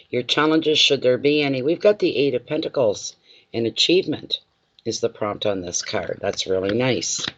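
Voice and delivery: ordinary talk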